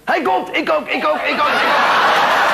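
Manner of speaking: high, excited chorus of voices building; mock climax. Dwarfs' relay reaches its climax.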